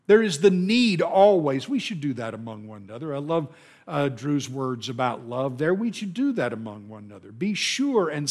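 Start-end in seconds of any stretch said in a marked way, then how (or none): none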